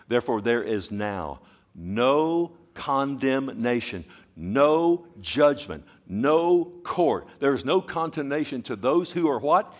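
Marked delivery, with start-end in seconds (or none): 0.1-7.3 slow, rising emphatic list. Shown as a demonstration. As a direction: lean on each no.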